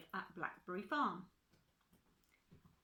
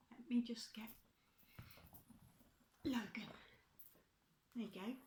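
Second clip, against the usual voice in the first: whisper; 2 semitones higher, 5 dB quieter, faster than usual. Real, not acted. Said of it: "fetching child".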